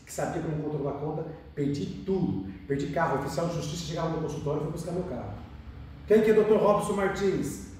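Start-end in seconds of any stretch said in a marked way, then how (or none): 6.1-7.7 loud, slow official calling out a name; stern official calling out. Retold or imitated. Bailiff at the dentist's office demanding the owner, loud and slow. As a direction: boom out the name like a bailiff at the door. Bailiff arrives to seize car.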